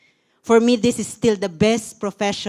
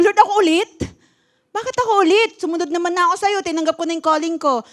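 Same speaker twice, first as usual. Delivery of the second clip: higher, pleading protest to God; retold protest; 6 semitones higher, faster than usual. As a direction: ask why me again, close to tears. Pastor retells protesting to God.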